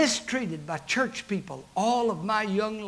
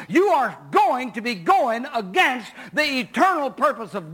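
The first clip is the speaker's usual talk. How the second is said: preaching at full force; 6 semitones higher than usual. Acted. Pastor warning his congregation not to fight God, preaching at full force. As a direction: drive the warning home to the pews. Preaching climax before the story.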